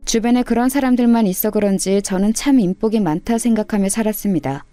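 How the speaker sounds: usual speaking voice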